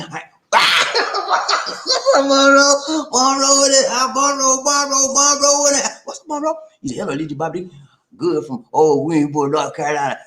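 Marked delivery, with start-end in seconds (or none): 0.5-2.1 long high-pitched burst of laughter; big high laugh. Real, not acted. 2.2-6.6 high chanting greeting, name repeated; excited shout-out. Real, not acted. Excitedly hails arriving guest Monroe.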